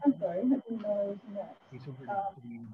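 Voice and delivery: faint, muffled bad microphone; sounding far from the mic. Real, not acted. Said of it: "we could barely hear you".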